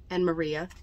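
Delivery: plain reading voice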